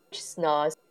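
ordinary delivery, nothing marked

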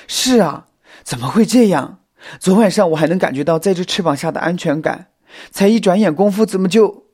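high, loud, upset lament; choking up. A narrator's reading. Xiao Mi mourning the lost sister, choking up. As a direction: cry out in grief and disbelief. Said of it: "小蜜也开始哽咽起来".

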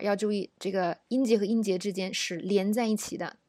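ordinary talk

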